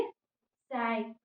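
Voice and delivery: "सैक" stretched to a long "ai" glide; long pronunciation. Shown as a demonstration. Teacher models the longer word, drawn-out "सैक". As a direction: hold the vowel long in "सैक". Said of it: "इसको थोड़ा long उच्चारण".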